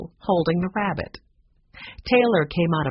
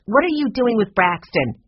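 higher, louder demand; a sharp, raised demand; 5 semitones higher, 5 dB louder than usual. A narrator's reading. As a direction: challenge them indignantly. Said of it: "she demanded".

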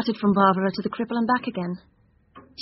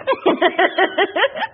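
put-on girlish giggle; 6 semitones higher than usual. [name] giggles.

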